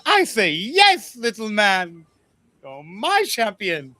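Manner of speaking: high, slow and grand acceptance; high regal swoon; queen accepting. Acted. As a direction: declare him your champion, drawn out.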